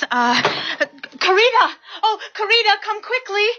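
high-pitched, hurried summons; urgent calling out. Acted. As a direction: call a colleague over urgently. Nurse calls out for help.

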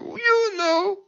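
very high, mock-indignant cry; livid accusation. Livid woman answering the pastor's question, mock indignant. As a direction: throw it back in an imitated outraged squeal. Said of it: "She was livid".

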